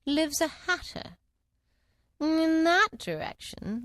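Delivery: slow, drawn-out Cheshire Cat; slow sly cat voice. Acted. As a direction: purr it out slowly like a grinning cat. Cat character voice.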